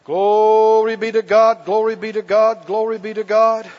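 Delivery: slow, loud drawn-out praise, half sung; worship praise. Acted. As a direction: pour it out to God over the music. Leads worship over music.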